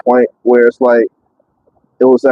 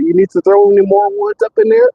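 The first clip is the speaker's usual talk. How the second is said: the forklift guy's line pitched way up high; mimicking the forklift guy. Retelling the forklift guy's question in a much higher voice. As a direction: lightly voice the coworker, much higher than yourself. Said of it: "he was like".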